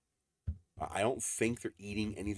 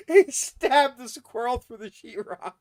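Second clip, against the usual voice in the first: higher and louder, laughing through it; still laughing; 11 dB louder, slower than usual. Real, not acted. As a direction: keep giggling as you say it.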